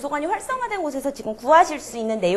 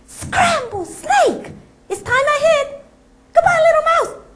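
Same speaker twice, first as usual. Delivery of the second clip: very high, loud panicked squeal; panicking snake; 5 dB louder, much slower than usual. Acted. The snake fleeing from the mouse in panic. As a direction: shriek it and flee.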